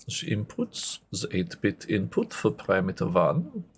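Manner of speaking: put-on hammy German-like accent, slow and deliberate; badly faked Germanic accent with swooping pitch; terrible put-on accent. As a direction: do a bad German-style accent, measured pace. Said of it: "That's a terrible accent".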